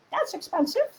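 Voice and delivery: high, soft squeak; little voice. Acted. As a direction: say it as a tiny nagging inner voice. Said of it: "programming from this little one".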